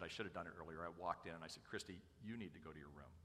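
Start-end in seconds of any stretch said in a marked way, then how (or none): 1.7-3.1 low, soft parental order; restrained dad. Retold or imitated. Father sending daughter to her room, restrained and quiet. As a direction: send her off firmly without raising your voice. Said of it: "I'm not a screamer or a shouter".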